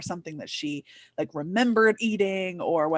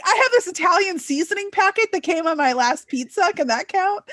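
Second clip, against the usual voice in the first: hopeful; 9 semitones higher than usual. Retold or imitated. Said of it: "I'd be like".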